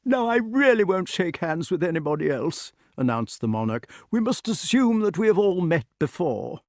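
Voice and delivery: put-on high, grandly announcing old king; pompous old knight. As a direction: declare it with royal bluster. Voices King Pellinore.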